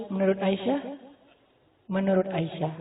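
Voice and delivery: soft, unhurried counter-question; gentle reply. Retold or imitated. Prophet answers his angry wife, gently and softly. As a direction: hand the question back quietly and calmly.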